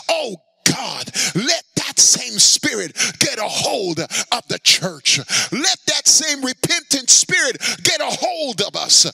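loud, high, crying out in prayer; fervent prayer. Real, not acted. Preacher crying out to God for the church. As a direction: plead with God for repentance.

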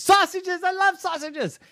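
put-on high squealing child delight; excited kid. Piper thrilled by sausages.

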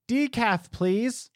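high, prim mock order; hammed-up customer ordering decaf; mock lady. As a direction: chirp it in a high put-on voice. Imitating the lady's order.

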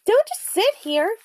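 raven croak. A narrator's reading. Annie urging Jack off the ledge, high croak. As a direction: chide him in a high bird voice. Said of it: "she croaked".

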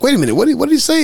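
high-pitched baffled double take; mock confusion. Podcaster voices himself missing a quick one-liner, puzzled. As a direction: act out a viewer who missed the line.

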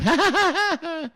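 a short high laugh; high burst of laughter. Real, not acted. Host laughing at his producer Trav, a high laugh.

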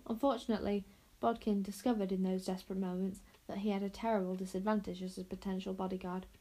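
usual speaking voice

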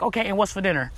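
low, soft, unbothered aside; indifferent shrug. Acted. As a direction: toss it off as if nobody cares. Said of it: "They're like".

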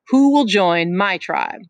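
chief Jack calling out slowly; lording it over the boys; slow, loud challenge. Acted. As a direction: draw it out loud to the crowd. Jack asks again.

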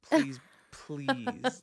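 loud, high, hammed-up begging; smitten pleading. Smitten shoe clerk to British customer, hammed-up pleading.

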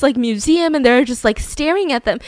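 normal talking voice

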